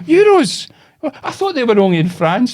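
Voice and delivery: loud, high incredulous old-lady impression; mock old lady in disbelief; wee old woman. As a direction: imitate a confused old woman, starting very high. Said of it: "this wee old woman".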